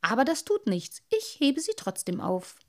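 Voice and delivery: put-on a proud little boy defending his finds; little water sprite. Little Wassermann speaks.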